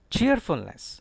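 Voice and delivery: cheerfulness with CHEER high and strong; stress on CHEER. Shown as a demonstration. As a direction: start cheerfulness high, fall through "fulness". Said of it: "Stress is on cheer".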